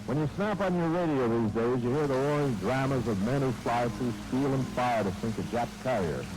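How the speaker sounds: normal talking voice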